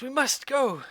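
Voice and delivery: high, urgent plea to move; urgent stranger. Acted. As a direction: push them to leave now. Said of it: "He says".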